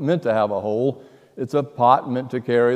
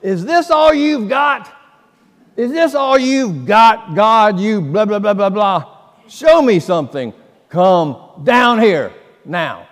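much higher, louder hammed-up shouting at the sky; retold defiant scream; 7 semitones higher, 6 dB louder than usual. Preacher acting out Lieutenant Dan defying God on the mast, loud mock scream. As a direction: shake your fist and bellow up at God.

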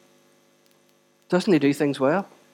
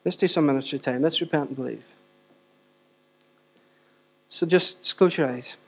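ministry time; 2 semitones lower, 9 dB quieter than usual. Acted.

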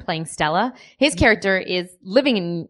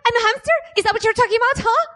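much higher squeaky hamster voice; sleep-deprived hamster; 12 semitones higher, faster than usual. Acted. Tara answers the host's challenge as a high squeaky hamster. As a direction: squeak it out like an indignant little rodent. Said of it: "a sleep-deprived South African hamster".

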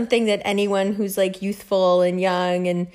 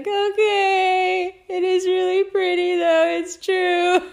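high, squeaky whine breaking into laughter; mock-tearful concession; 10 semitones higher, slower than usual. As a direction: give in like a whimpering child. Reluctant children give in, mock tearful.

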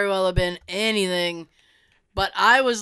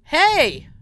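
higher, louder call from outside; mysterious voice calling hey; 5 semitones higher, 6 dB louder than usual. Retold or imitated. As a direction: call out hey like a stranger at the window. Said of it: "I heard".

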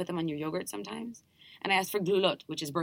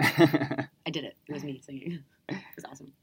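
whispering; 3 semitones lower than usual. Pretend. Joking whisper after laugh.

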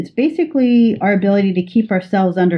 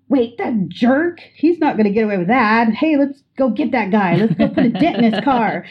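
higher, hammed-up mock outrage at the other driver; revved-up friend; 4 semitones higher than usual. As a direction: egg the friend on like you want to chase the guy. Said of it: "you're getting revved up yourself".

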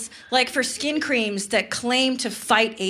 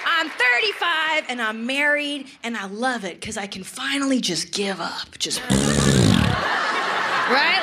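usual speaking voice

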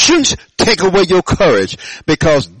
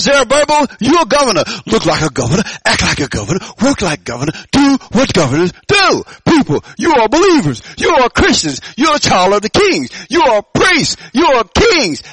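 high, rousing sermon charge; full-force preaching; 5 semitones higher than usual. Real, not acted. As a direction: drive each short declaration home, pitched high.

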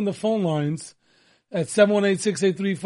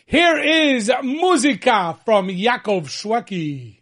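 big, slow, raised announcer intro; radio announcer; 4 semitones higher, 4 dB louder, much slower than usual. Real, not acted. Host introduces the next song to listeners, grand announcer voice. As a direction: draw out the song intro with showy radio flourish.

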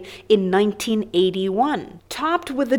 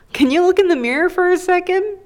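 sassy question; 9 semitones higher, 3 dB louder, faster than usual. Pretend.

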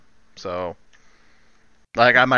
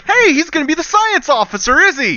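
mock excited; 6 dB louder than usual. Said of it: "I was like".

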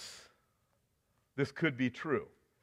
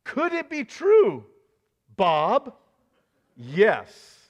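dramatic question; 3 semitones higher, 5 dB louder, much slower than usual. Acted. Preacher's dramatic refrain.

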